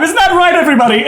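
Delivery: high, theatrical call-out; playful host working the audience; showman rallying crowd. Acted. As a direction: toss it out to the whole room. Host rallies his crowd.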